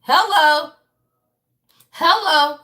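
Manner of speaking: loud, pointed hello, pitch up; emphatic hello. Real, not acted. Reader calling out viewers on what the cards show, pointed and loud. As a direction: call it out like you mean it.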